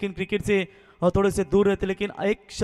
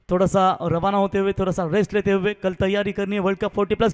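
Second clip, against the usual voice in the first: ordinary talk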